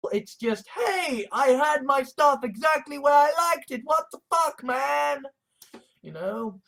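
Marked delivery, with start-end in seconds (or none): 0.7-5.2 higher and louder, an aggrieved yell; indignant shout. Retold or imitated. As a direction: blurt it out in outrage, loud and high. Retold indignant outburst.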